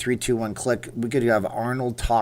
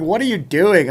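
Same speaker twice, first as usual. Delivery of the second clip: lightly voiced wife, high and loud; retold spousal challenge, pitched up; wife calling out; 8 semitones higher, 8 dB louder than usual. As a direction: imitate a baffled wife's raised voice. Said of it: "she's closing the door going".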